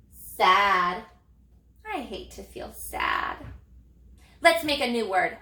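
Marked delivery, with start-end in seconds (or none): 1.9-3.4 mock sad. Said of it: "I'm tired of being sad".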